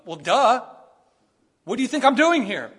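loud, high mock-exasperated retort; sarcastic duh. Preacher imagining his own snarky reply, mock exasperated. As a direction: scoff like it's obvious. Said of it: "My first thought would be".

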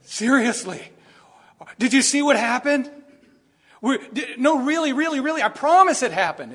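high, loud, insistent pleading; mock overselling. Preacher parodying an embellishing Bible, overeager insistence. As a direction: oversell it like a liar. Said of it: "It doesn't say".

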